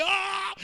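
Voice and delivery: high mock wail; imitated weeping. Ana crying at the altar, imitated wail. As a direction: cry out wordlessly like a weeping woman. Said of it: "lloraba y".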